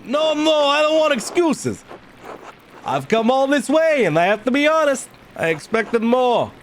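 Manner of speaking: high-pitched, demanding nobleman; imperious visiting lord. Acted. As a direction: question the prior in a high, lordly voice.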